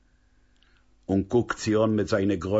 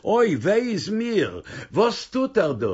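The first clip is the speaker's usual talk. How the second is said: a startled mother crying out, much higher; mother's shocked, high-pitched cry; frightened cry; 11 semitones higher, 4 dB louder than usual. Acted. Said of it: "ערשראקן אויסגעשריען".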